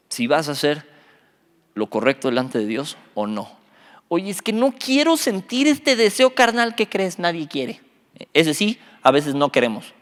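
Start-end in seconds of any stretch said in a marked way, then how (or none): 4.1-6.7 pleading complaint. Acted. Acting a reluctant believer's complaint.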